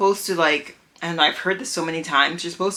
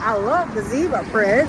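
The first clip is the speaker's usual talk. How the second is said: light imitation of a sweet Southern accent; 5 semitones higher, 4 dB louder than usual. Texan cashier praising the zebra print, mock Southern accent. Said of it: "very southern accent".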